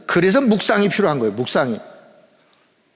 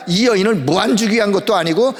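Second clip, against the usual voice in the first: ordinary delivery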